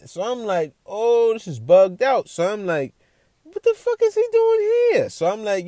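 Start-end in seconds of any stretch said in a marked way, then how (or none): 0.9-2.3 amazed. Retold or imitated. Reacting to the family pictures. 3.4-5.1 shocked, squeaky disbelief. Retold or imitated. Narrator recalling his shock at meeting [name]'s son.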